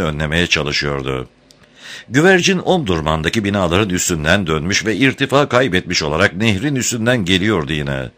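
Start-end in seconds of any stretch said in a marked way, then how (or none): none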